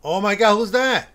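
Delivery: loud, high startled cry. Real, not acted. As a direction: jump at the sight. Sudden figure appears.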